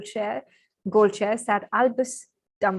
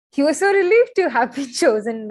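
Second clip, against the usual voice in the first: higher and louder, breaking into a real laugh; gushing with real delight over the page; excited reading; 5 semitones higher, 6 dB louder than usual. Real, not acted. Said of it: "I'm so excited while I'm reading this".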